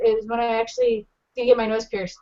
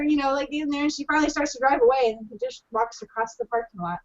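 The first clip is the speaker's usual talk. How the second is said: normal talking voice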